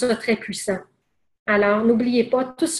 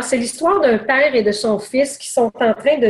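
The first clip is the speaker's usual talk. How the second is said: ordinary delivery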